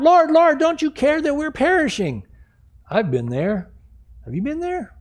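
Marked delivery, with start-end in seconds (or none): 0.0-2.2 high-pitched acted panicked plea; freaking out. Disciples waking Jesus in the storm, acted panic. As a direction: plead urgently, pitched way up. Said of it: "They start to freak out".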